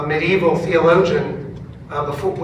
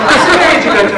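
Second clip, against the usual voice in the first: higher, louder eager ask; eager question; 8 semitones higher, 9 dB louder, much faster than usual. Retold or imitated. Choir members asking the pastor, eager and louder. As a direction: lean in, keen to know.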